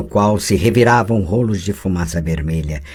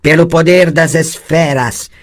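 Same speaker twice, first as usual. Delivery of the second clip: groaned invocation; 9 semitones higher, 7 dB louder than usual. Acted. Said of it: "gemeu a feiticeira".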